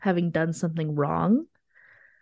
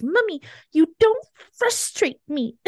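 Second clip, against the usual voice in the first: put-on much higher, slow little-kid voice; imitating her toddler; 10 semitones higher than usual. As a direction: mimic a three-year-old, slow and high. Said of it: "he goes".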